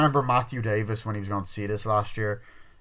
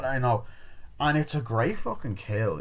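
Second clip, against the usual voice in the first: ordinary talk, nothing marked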